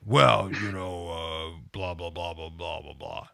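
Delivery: low, droning blah-blah doctor voice, fading off; miserable mumbling doctor. Retold or imitated. Imitating the grumpy urologist brushing him off, low and fading. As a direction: grumble it out like a bored old doctor. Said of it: "spouted off some miserable shit".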